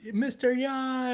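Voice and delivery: high, drawn-out wail imitating children; mock crying kids. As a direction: wail it slowly in a squeaky kid voice. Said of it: "the giant kids are all crying".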